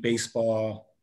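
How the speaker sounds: ordinary talk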